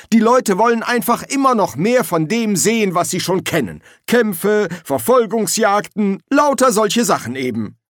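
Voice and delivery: high, emphatic studio boss; Silberfisch. Acted. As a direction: insist on what the public wants.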